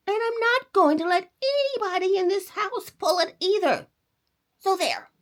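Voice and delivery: put-on high, loud pouty boy; a defiant kid stamping his foot; stubborn boy. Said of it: "He ran and hid".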